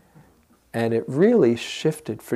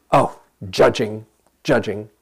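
harsh noting; 5 dB louder than usual. Shown as a demonstration. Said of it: "it's easy to get into".

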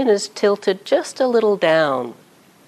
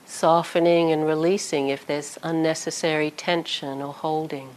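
calm guided body scan with long pauses; unhurried meditation voice, leaving space after each phrase; 3 dB quieter than usual. Real, not acted. Teacher guiding sitters through a body scan, calm meditation voice.

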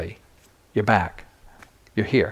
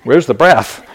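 loud incredulous laugh through the words; amused scoffing, laughing out loud; laughing disbelief; 7 semitones higher, 7 dB louder, slower than usual. Retold or imitated. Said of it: "And I was thinking".

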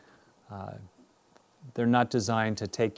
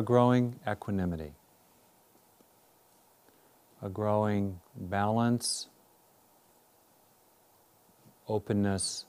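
guided meditation. Real, not acted. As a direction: guide them inward, unhurried and measured.

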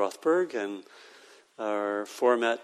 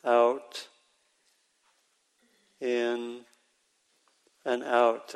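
slow, spaced-out labels with long pauses; quiet mental label; much slower than usual. Shown as a demonstration. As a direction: say each label plainly, leaving room for a breath. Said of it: "give a very quiet label".

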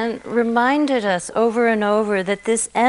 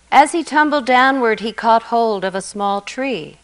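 ordinary talk

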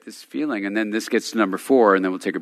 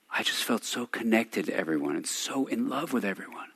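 quiet and teary; 10 dB quieter than usual. Retold or imitated. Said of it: "really quiet".

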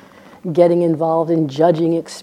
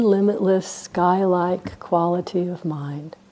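guided meditation. Real, not acted. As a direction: guide them into stillness, leaving silence between phrases.

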